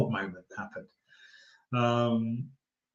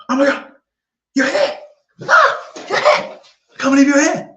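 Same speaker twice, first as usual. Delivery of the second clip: very high, loud squealing fan; imitating excited fan; 11 dB louder than usual. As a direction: gush like a starstruck fan. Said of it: "he was so excited".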